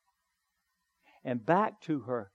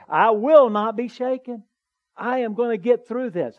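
loud declaration; 6 semitones higher, 9 dB louder than usual. Shown as a demonstration. Preacher urging the church to declare aloud, loud defiant proclamation. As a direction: raise your voice and proclaim "I will not be shaken". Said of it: "And just say".

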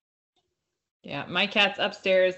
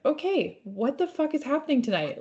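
retold exasperation, pitched well up; mock-bewildered outburst at normal volume; exasperated disbelief; 6 semitones higher than usual. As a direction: replay a higher-pitched "what is going on" moment. Retelling her alarmed reaction that night.

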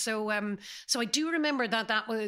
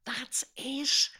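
whispered aside; 3 semitones higher than usual. Real, not acted. Confiding aside about Tesco.

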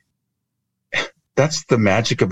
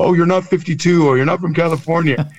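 much higher, quick objecting audience voice; audience guessing; 9 semitones higher than usual. Retold or imitated. Workshop audience guesses the speaker's lie, higher-pitched imitation. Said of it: "And people go".

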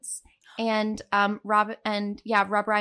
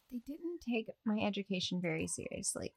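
whispered aside, unvoiced; 2 semitones lower, 8 dB quieter than usual. Real, not acted. Cohost confides about the school in a whisper. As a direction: let it out as a secret.

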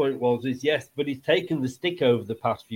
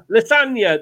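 hammed-up Italian accent on one word, higher and louder; mock Italian accent; 6 semitones higher, 6 dB louder than usual. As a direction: throw out Lasagna like a cartoon Italian chef. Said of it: "Are you gonna do it in an Italian accent?".

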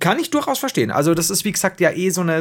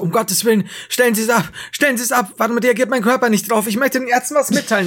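higher pitched mock panic; panicked plea; 8 semitones higher, 3 dB louder than usual. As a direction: plead in a hammed-up panic, higher. Sedated patient panicking in surgery.

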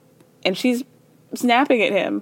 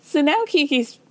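ordinary talk, nothing marked

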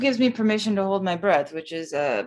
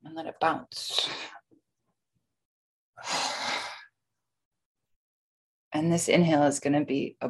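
guided breathwork. Acted. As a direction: lead the breathing gently. Guides a box breathing session.

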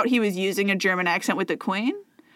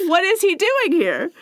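pitched-up shocked question, voice put on; mock British maid again; 8 semitones higher than usual. Quoting the maid for her co-host, higher mock-shocked voice. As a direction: repeat the maid's line higher and rising. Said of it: "And she says".

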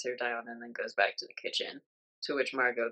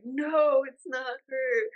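a much higher, louder alarmed no, then a slow it's not her; alarmed cry; 12 semitones higher, 6 dB louder, much slower than usual. Retold or imitated. Guests scream at the gunman aiming at Margot, high alarmed cry. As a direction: cry out in horror as the gun swings.

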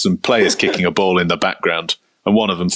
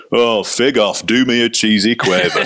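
lightly put-on gruff Yorkshire voice; a hint of a grumbling Yorkshireman; Yorkshire grumble; 4 semitones higher than usual. Mick McCarthy between press questions, mild Yorkshire grumble. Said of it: "the gruff old Yorkshireman".

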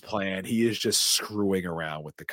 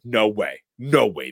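annoyed; 3 semitones higher, 6 dB louder than usual. Retold or imitated. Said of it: "Annoyed Deputy Dwayne is like".

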